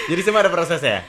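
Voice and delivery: high, loud laughing follow-up; laughing question. Real, not acted. Host laughing at the guest's blunt skincare answer.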